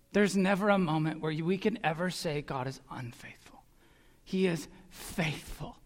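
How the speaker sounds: hushed sincere promise; whispered assurance. Real, not acted. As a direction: lean in and whisper it.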